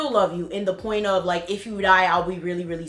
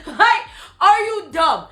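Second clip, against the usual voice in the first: higher, laughing mock yell; retold yelling; 7 semitones higher than usual. Retelling yelling at her ex in the street, a higher laughing mock yell. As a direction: yell it at him half laughing. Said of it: "I just start yelling at him".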